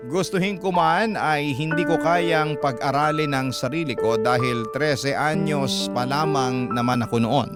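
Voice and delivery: ordinary delivery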